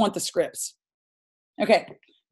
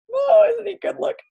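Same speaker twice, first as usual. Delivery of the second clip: mock swooning; 3 dB louder than usual. Gushing over the author's photo.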